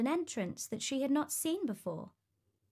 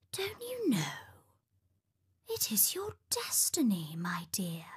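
put-on old woman at the wheel; 3 dB quieter than usual. A narrator's reading. Old woman answers the princess about her destiny, whispery old woman voice.

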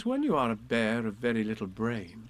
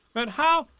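high, loud eager question; fussy rabbit asking; 14 semitones higher, 8 dB louder than usual. A narrator's reading.

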